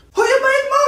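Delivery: sharp call after them; shouting after customers who skipped the bill. Acted. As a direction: yell for the unpaid bill. Waiter calls after leaving customers.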